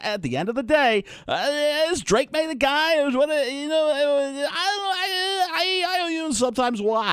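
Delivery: excitable draft analyst, pitch climbing high; rambling sports-pundit impression; Mel Kiper. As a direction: rattle on like a TV pundit, stammering into a high flurry. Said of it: "please do Kiper".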